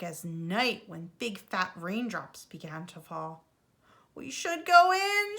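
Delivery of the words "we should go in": much higher, louder anxious shout. A narrator's reading. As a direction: call out over the storm to friends. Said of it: "shouted Franklin".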